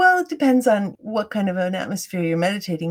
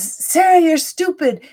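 higher, louder mock critic; harsh inner critic; 7 semitones higher, 7 dB louder than usual. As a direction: snap it out like a scornful inner voice. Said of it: "the voice says".

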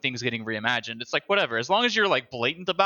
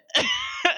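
burst of laughter. Real, not acted. Laughing at the trailer joke.

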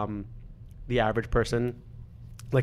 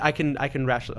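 usual speaking voice

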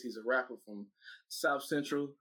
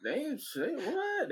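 high, slow mock surprise; mock disbelief; 13 semitones higher, much slower than usual. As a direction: stretch it out in disbelief.

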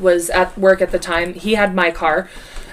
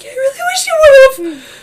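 much higher, slow whiny sister voice; imitated wistful sister; 7 dB louder, slower than usual. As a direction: whine it like the regretful sister.

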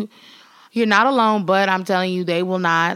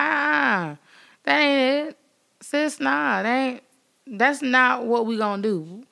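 sassy refusal; 5 semitones higher than usual. Pretend. Acted refusal quote to sis.

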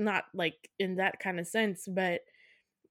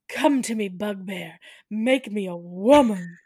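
slow, louder melodramatic plea; dramatic seduction; 6 dB louder than usual. Retold or imitated. Wife drapes herself on bed calling her husband, hammy seduction. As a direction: vamp it up theatrically. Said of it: "lays back all dramatic".

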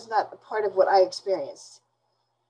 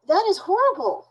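sharply higher, real outrage; appalled; 11 semitones higher than usual. Real, not acted. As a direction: spike up in disbelief. Appalled by the killers' callousness.

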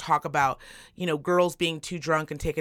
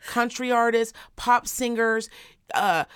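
ordinary delivery

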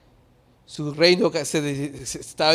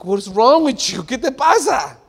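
high, louder acted challenge; mock exasperation; 8 semitones higher, 8 dB louder, slower than usual. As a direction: confront them in disbelief. Acts exasperated reaction to others. (exasperado, como reclamando)

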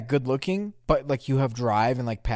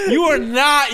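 high, loud "you are not"; loud disbelief; 12 semitones higher, 8 dB louder, slower than usual. Real, not acted. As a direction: shout it in disbelief. Disbelief at his self-rating.

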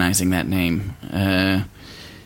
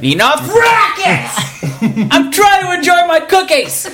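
very high, drawn-out shriek of annoyance; outraged yelling; slower than usual. Acted. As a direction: yell like someone robbed of their peace. Acted angry outburst over cookies.

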